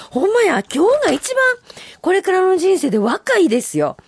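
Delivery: high, loud delighted exclaim; excited agreement. Real, not acted. Host agreeing with a listener's haiku, delighted.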